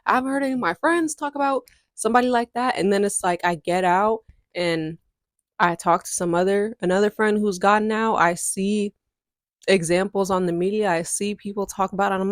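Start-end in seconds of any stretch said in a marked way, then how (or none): none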